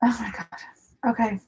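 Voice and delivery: breathless whispered gasp; breathy, shaken hyperventilating. Real, not acted. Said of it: "I'm kind of hyperventilating right now".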